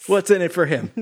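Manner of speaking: high, laughing mock line. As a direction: laugh through the question. Mocking character, laughing.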